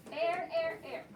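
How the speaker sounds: "air" said slowly and clearly, open vowel into R; air sound. Shown as a demonstration. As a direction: model the word "air" for the class, stretching its vowel. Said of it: "is the air sound".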